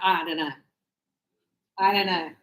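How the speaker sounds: low, offhand imitation of a student; a hint of a low-voiced shrug; imitating a student. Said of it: "And Danny was like".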